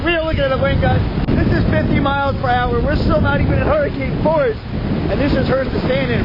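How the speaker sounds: yelling over the wind. Real, not acted. Reporter in a storm yells to Brian over the gusts. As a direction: shout through the stinging wind. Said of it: "Wow, this hurts".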